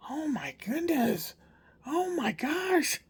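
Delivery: put-on high, slow, wide-eyed child voice; amazed little boy. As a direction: play the grandson gasping in wonder. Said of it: "and he's like".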